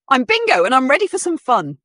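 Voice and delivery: high, loud cheerful pup; little girl voice. Acted. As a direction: chirp it like a little puppy. Child character voiced in story.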